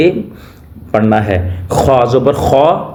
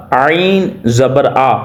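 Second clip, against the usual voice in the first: normal talking voice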